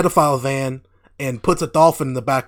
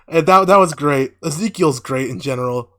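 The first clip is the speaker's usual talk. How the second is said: usual speaking voice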